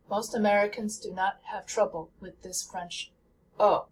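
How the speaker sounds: usual speaking voice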